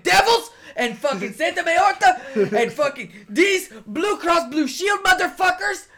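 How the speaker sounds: high, loud in-character rant; frustrated yelling. Acted. [name] ranting at Breeze about devils, frustrated yelling. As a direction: build into an exasperated yell. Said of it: "I did not mean to yell at you".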